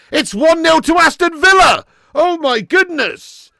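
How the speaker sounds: high, loud shout of celebration; excited goal call. Real, not acted. Commentator calling Villa's goal, excited shout. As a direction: shout the goal, then slow right down in amazement.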